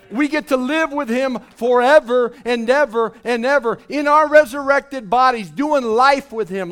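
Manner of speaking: loud, rising preacher's cadence; fiery preaching. Acted. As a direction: proclaim it to the whole hall. Preaching at full force.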